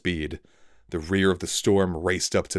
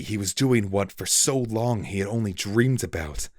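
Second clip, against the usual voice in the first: ordinary delivery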